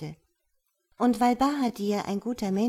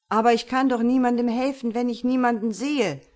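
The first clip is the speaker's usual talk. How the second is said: fast and louder protest; complaining; 4 semitones higher, 6 dB louder, faster than usual. Acted. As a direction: rattle off the complaint, exasperated. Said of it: "beschwerte er sich". (schnell und lauter beschwerend)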